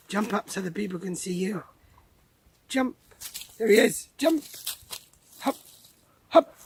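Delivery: high-pitched coaxing to a dog; calling the dog. Real, not acted. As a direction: urge the dog up in short, high calls.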